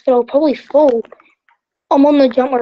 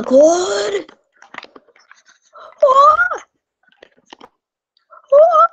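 panicked scream; 12 semitones higher, much slower than usual. Real, not acted. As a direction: scream as you lose your grip. Falling in the game.

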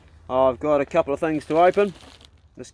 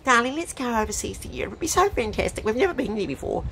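high, gushing mock wife voice; put-on high feminine wheedling; pleading wife; 7 semitones higher, 4 dB quieter than usual. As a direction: coax the husband in a raised pitch. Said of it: "she'd be asking".

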